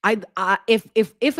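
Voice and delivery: stuttering with excitement; tripping over the words. Real, not acted. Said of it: "and I just got a stutter".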